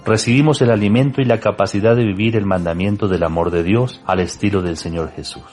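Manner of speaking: usual speaking voice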